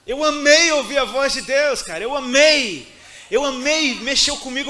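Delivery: impassioned cry. Real, not acted. Preaching at full force.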